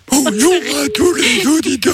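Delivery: slow inhaled announcer; talking while inhaling. Shown as a demonstration. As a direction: breathe in as you announce. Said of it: "Je suis un professionnel".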